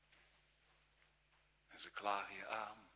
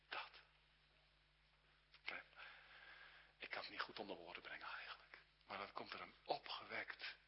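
intimate whisper; 4 semitones lower, 6 dB quieter than usual. Real, not acted. Preacher speaks of inner joy.

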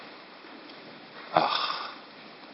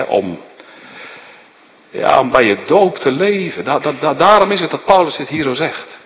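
ordinary delivery

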